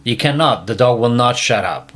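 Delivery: usual speaking voice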